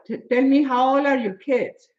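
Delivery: normal talking voice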